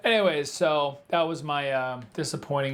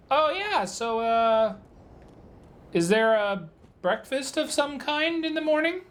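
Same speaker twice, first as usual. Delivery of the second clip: feigned innocent cheer; 9 semitones higher than usual. Retold or imitated. Playing dumb to the clerk.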